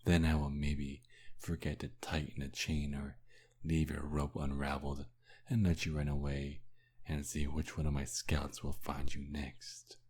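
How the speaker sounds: ordinary talk, nothing marked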